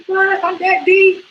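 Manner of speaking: mock outrage at being moved to tenors; shocked. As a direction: squeak it out in disbelief. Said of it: "I was like".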